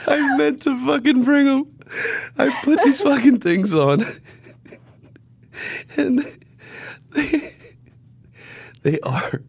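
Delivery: words squeezed out through laughter; laughing while talking. Real, not acted. Telling a friend about ridiculous pants, cracking up.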